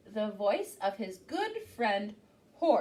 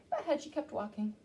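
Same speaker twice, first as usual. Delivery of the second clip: usual speaking voice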